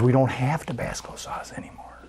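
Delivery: hushed secretive whisper. Retold or imitated. Waitress whispering to the pastor about the sauce. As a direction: whisper it so nobody overhears.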